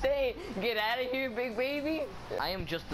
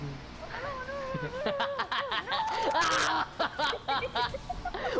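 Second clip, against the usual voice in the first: high laugh; 12 semitones higher than usual. Real, not acted. Playful gaming banter.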